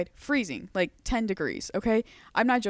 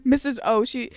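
ordinary talk, nothing marked

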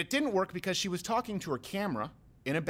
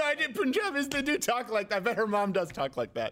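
normal talking voice

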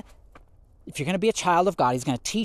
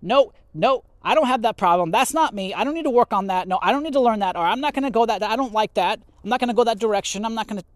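higher, louder mock denial, nope after nope; stubborn refusal; 7 semitones higher, 3 dB louder than usual. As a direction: brush it all off like a stubborn kid.

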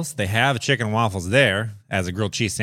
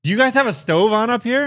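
quoting roommate; 10 semitones higher, 4 dB louder than usual. Retold or imitated. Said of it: "He's like".

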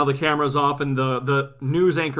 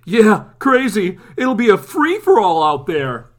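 louder, slow drawn "yeah, crazy"; casual cameraman; 5 semitones higher, 6 dB louder than usual. Retold or imitated. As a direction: shrug it off loudly. Cameraman voiced.

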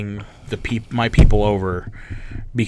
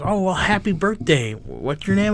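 lightly imitated neighbor, pitched higher; hint of a chirpy party-crasher; retold birthday greeting in a higher voice; neighbor woman; 7 semitones higher than usual. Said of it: "She goes".

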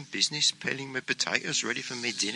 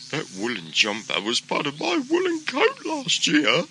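higher sheep voice; higher sheep complaining; 10 semitones higher, 3 dB louder than usual. Acted. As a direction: speak as the sheep. Sheep Nicole speaks.

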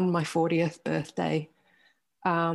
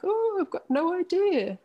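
much higher, mock airy doctor voice; mock clueless doctor; 12 semitones higher than usual. Doctors with no answer for her, mimicked high and airy. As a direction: parody a doctor who has no answers, high and light.

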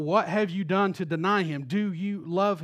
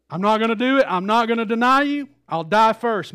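boastful vow; 5 semitones higher, 10 dB louder than usual. Retold or imitated. Peter's boastful vow of loyalty.

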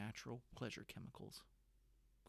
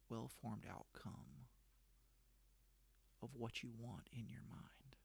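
guided meditation; 4 dB quieter than usual. Acted. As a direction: guide them gently into the vision.

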